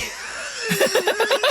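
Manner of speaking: real high-pitched burst of laughter. Real, not acted. Comedian cracks up at his wife remark, laughing.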